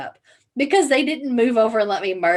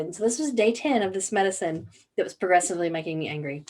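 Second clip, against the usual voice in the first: ordinary talk, nothing marked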